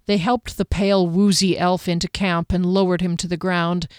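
usual speaking voice

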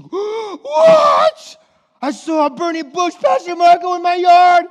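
excited shout. Pretend. Churchgoer telling Pastor Marco about the bush, shouting amazed. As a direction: shout in wide-eyed amazement. Mock burning bush reaction.

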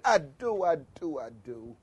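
dropping from preaching voice to very quiet; winding down. Shown as a demonstration. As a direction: let each repeat sink further toward a low murmur. Tapers down into calm demonstration.

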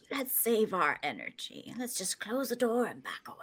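soft, low near-whisper; quiet, wary suggestion fading to whisper; hushed caution. Acted. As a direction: urge a quiet retreat under your breath. Urging a quiet retreat from danger.

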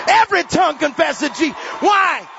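high, shouted proclamation to the crowd; full-force preaching. Real, not acted. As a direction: thunder it out, pitched high.

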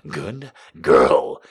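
low commanding voice. Acted.

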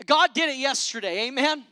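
loud, rising preacher's cadence; preaching at full force. Acted. Preacher rallying the church, loud and rising. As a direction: preach it out to the congregation.

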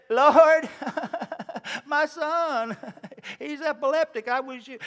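high, choked plea to Jesus; pleading father. Acted. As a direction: beg like a desperate father, voice cracking. Father begging Jesus for son.

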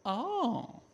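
a calm little oh of surprise; hammed-up surprised oh; mock surprise. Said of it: "She goes".

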